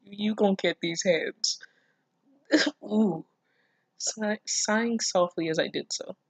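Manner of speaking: ordinary talk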